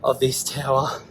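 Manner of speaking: out of breath from the climb; puffing between phrases, winded; breathless at high altitude. Real, not acted. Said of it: "I'm out of breath from climbing up here".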